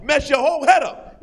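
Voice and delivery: ordinary talk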